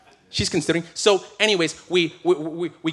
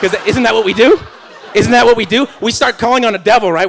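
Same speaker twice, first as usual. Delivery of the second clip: loud laughing, raised pitch, hammed-up; mock preacher shout; 5 semitones higher, 11 dB louder than usual. Pastor mocks Christians blaming the devil, loud laughing. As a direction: ham up the blame-the-devil bit.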